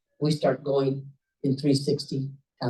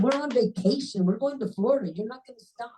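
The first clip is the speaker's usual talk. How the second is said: raised-pitch retold spousal protest; lightly acted wife objecting; 7 semitones higher than usual. Wife telling Hector not to stop on vacation, protesting.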